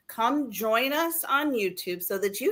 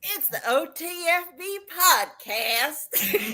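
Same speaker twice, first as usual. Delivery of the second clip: slow, high Southern drawl, laughing; 9 semitones higher, slower than usual. Pretend. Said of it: "fake Southern drawl".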